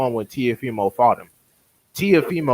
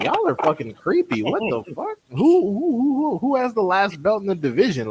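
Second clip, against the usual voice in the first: exasperated retort; 9 semitones higher than usual. Retold or imitated. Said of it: "I'm like".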